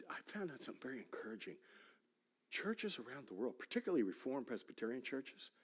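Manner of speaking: a quick whisper, like sharing a secret; excited whisper. Real, not acted. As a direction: hush and rush it as a thrilling secret. Sharing exciting news confidentially.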